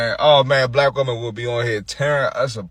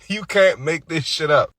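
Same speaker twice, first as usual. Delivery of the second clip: ordinary talk, nothing marked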